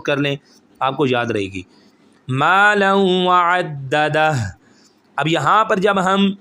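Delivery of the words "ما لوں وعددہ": ما لوں وعددہ chanted slowly with ghunna on لوں; slow recitation. Shown as a demonstration. Teacher reciting the verse for students, slow chant. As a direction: recite it like Quran, slow and nasal. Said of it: "رکیں گے وعددہ بنے گا".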